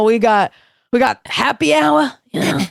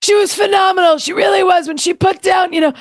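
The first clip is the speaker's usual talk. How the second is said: Joan Rivers impression; 5 semitones higher than usual. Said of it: "Joan Rivers on Nikki Glazer's performance".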